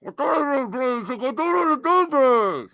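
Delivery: slurred as if through a mouthful; mouth full. Pretend. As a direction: talk around an apple in your mouth. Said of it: "Get the apple out of your mouth".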